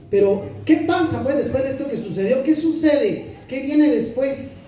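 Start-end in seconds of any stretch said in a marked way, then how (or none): none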